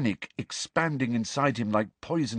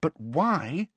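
higher, insistent question; mildly pressing objection; persistent; 7 semitones higher than usual. A narrator's reading. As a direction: press for a reason. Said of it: "Hermione persisted".

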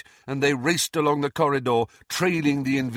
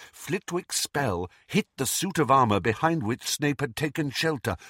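plain reading voice

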